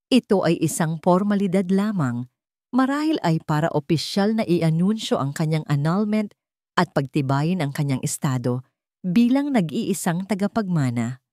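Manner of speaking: ordinary delivery, nothing marked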